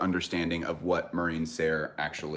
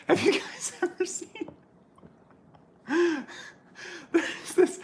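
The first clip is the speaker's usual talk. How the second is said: high airy laughter through the words; can barely speak for laughing; giggling breathless. Real, not acted.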